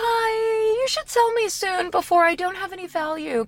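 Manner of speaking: high, sly car voice; talking car voice. Pretend. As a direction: speak as the car, pleading. Car voice replying to friend.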